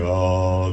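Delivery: low, steady held "ah"; thick-voiced "ah". Shown as a demonstration. Teacher showing how prayers of power sound, thick-voiced "ah". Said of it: "густым голосом произносятся".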